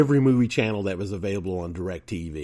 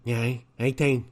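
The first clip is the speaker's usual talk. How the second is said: higher, slurred, vacant; a light put-on simple voice; mock dim-witted voice; 4 semitones higher, slower than usual. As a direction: play someone not all there.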